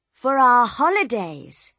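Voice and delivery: put-on high, loud chirpy child; excited little girl. A narrator's reading. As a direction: announce it proudly like a kid. Little girl tells Bill.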